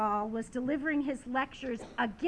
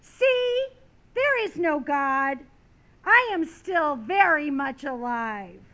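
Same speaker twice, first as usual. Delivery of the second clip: loud, triumphant scoffing; mocking proclamation, punched out loud; derisive exclamation; 2 semitones higher, 8 dB louder than usual. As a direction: crow it loudly with derision. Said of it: "the atheist exclaimed derisively".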